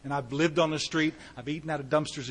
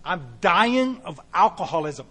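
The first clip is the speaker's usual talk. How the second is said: ordinary delivery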